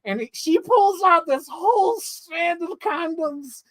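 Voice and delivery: ordinary talk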